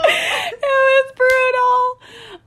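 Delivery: high, drawn-out cry through laughter; half laughing, half mock-crying lament; laughing wail. Real, not acted. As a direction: wail it slowly while cracking up. Laughing wail over brutal scene.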